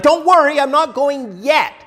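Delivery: high, gentle reassurance; reassuring Jesus. Retold or imitated. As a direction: comfort a worried friend.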